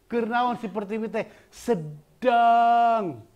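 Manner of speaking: slow down and lean on "sedang"; slow pressed. Shown as a demonstration. Teacher reading the line slowly and pressed. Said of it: "minta lambat".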